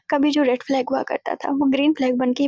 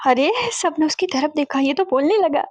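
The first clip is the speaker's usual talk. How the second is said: ordinary delivery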